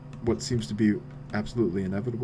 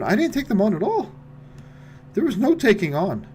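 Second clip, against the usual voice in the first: defensive protest; 10 semitones higher than usual. Real, not acted. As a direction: insist you did nothing wrong. Denying blame, defensive.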